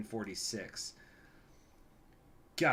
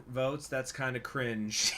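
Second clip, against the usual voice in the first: ordinary talk, nothing marked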